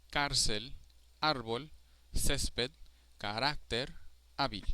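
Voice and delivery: cárcel, árbol, césped, carácter, hábil with stressed accented vowels; stress on second-to-last. Shown as a demonstration. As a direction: punch the accented syllable in cárcel through hábil. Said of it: "on the second to last syllable".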